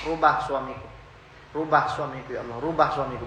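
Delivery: softly pleading; a wife's quiet plea to God, asking for her husband to change; quiet pleading prayer. Retold or imitated. Wife praying softly to God for her husband's change, in a quiet plea. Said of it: "berdoa sama Allah".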